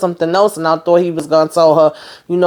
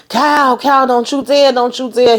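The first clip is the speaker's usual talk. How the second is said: higher, urgent mock warning; desperate plea; 7 semitones higher than usual. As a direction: beg him not to do it. Max pleading with Cal.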